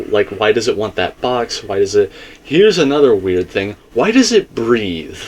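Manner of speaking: ordinary talk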